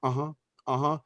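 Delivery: soft, bored uh-huh, not listening; hollow agreement while tuning him out; dismissive uh-huh. Retold or imitated. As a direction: brush him off with quiet uh-huhs. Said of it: "my face would have been like".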